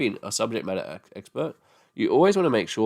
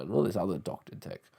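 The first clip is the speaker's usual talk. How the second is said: normal talking voice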